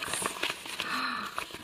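a sharp breathless gasp of delight; surprised gasp. Real, not acted. Teacher opening the crumpled paper for students, soft surprised gasp. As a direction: gasp in wonder at the result.

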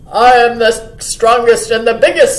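loud, assertive deer voice; young buck. A narrator's reading. Young buck claiming to be strongest, loud and assertive. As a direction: puff it out as the biggest. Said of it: "said the young buck".

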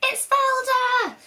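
high, loud, drawn-out screech; screeching squirrels. A narrator's reading. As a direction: screech the alarm to the treetops. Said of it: "they screeched".

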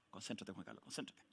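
soft, low note to himself; quiet aside. Real, not acted. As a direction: say it under your breath. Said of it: "aside to himself".

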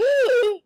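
loud high sharp intake of breath; shocked gasp. A narrator's reading. Reader voicing Diana's shock at Anne's news, loud gasp. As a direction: gasp in startled disbelief. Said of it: "Diana gasped".

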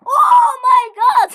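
slow, stretched, very loud high scream; ecstatic scream. Real, not acted. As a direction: scream it out in disbelief. Reacting to a win.

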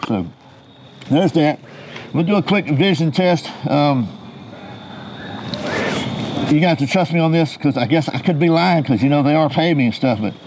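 muffled behind respirator; speaking inside full face mask; through a gas mask. Real, not acted. Seen 0: Full-face respirator with filter worn over mouth throughout the labelled words.

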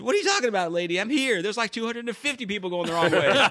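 very high, laughing through the punchline; exasperated old man, cracking up; indignant husband. Acted. Husband answers in joke.

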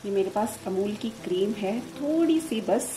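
normal talking voice

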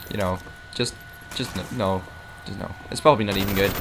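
ordinary talk

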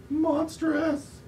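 very high-pitched storybook alarm; acted dread. Reader voices frightened character describing the monster, alarmed. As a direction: exclaim it in exaggerated horror.

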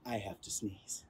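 whisper-like dread of the sneeze; hushed storybook suspense; hushed tension. A narrator's reading.

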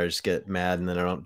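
ordinary delivery

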